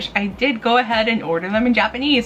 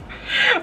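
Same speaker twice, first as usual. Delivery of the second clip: quick high-pitched giggle; embarrassed little giggle; 14 semitones higher than usual. Real, not acted. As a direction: giggle at your own word. Giggles at saying hanime.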